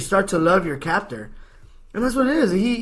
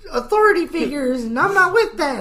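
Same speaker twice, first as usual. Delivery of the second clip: high put-on child voice drifting into an accent; kid with accent; 9 semitones higher than usual. Little Jimmy explaining why he burned the house, high mock-kid voice. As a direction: play a self-justifying little kid, pitched way up. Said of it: "I don't know why he picked up an accent towards the end".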